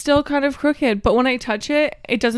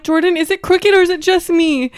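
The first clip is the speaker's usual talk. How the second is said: raised, urgent retold question; acted panic; 7 semitones higher, 7 dB louder than usual. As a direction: act out asking in alarm, high and loud. Retelling her panic to Jordan.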